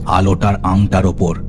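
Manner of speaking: ordinary delivery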